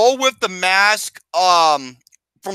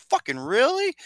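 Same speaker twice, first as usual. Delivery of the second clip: incredulous; 6 semitones higher, 5 dB quieter than usual. Retold or imitated. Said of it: "I'm just thinking to myself".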